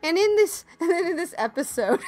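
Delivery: breaking into laughter, voice up high; giggling through the restart; laughing. Real, not acted. Podcaster cracks up at her own Russian accent, laughing. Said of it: "that's my Russian accent".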